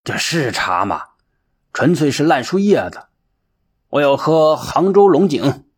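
mildly voiced scolding old monk; scold, then rise into a demand. A narrator's reading. Old monk rebuking his helper over the tea, scolding. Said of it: "并且训斥弃子".

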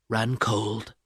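ordinary delivery, nothing marked

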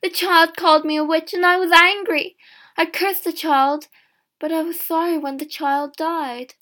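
loud, high-pitched confession; heated outburst, pitched well up; crying out. A narrator's reading. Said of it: "Elizon cried".

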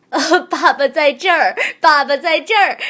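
overjoyed. Acted. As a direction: answer bursting with joy. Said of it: "公鹅兴高采烈地回应".

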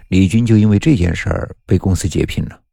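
ordinary delivery, nothing marked

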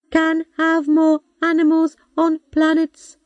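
high, slow, even machine voice; computer voice. A narrator's reading. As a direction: speak like a patient old robot. Robot computer speaks.

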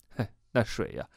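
plain reading voice

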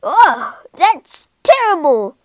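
loud, revolted outcry; loud warthog complaint; shouted disgust. A narrator's reading. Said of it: "he shouted".

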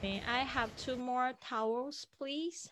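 "please" lifted high at the end; rising polite request. Shown as a demonstration. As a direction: end "please" on a clear upward lift. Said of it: "你会有一些要求".

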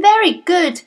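plain reading voice

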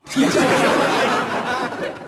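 high laughing burst; bright high laugh; high giggle. Real, not acted. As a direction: giggle at own joke. Laughs at own joke.

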